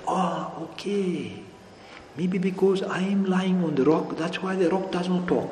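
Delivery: drawn-out realization, then quiet musing; thinking to oneself. Acted. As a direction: muse softly, as if working it out. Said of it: "the crocodile thought".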